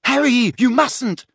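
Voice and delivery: high, loud alarmed shriek. A narrator's reading. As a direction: shriek it to stop a friend. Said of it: "shrieked Hermione".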